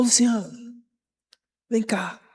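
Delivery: ordinary delivery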